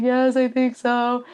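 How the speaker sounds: slow, drawn-out, imitated near-tears reply; mock teary and wavering; on the verge of tears. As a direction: answer slowly as if about to cry. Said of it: "like, on the verge of tears".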